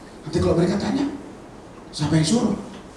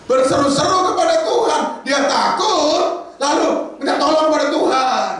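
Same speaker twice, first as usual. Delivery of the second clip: loud urgent cry to God; 11 semitones higher, 4 dB louder than usual. Acted. Preacher to the congregation, crying out loud. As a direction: cry out to the Lord for help.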